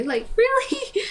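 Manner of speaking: high, loud laugh of disbelief. Real, not acted. Gamer scoffs at the game's fake sympathy, laughing loudly. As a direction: cackle out the question, incredulous.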